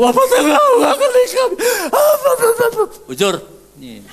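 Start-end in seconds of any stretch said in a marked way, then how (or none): 0.0-2.8 very high, fast, loud crying-laughing babble; imitating a Karo mourner's frantic high wail; mock Karo wailing. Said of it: "Persis orang karo".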